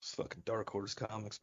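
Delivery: usual speaking voice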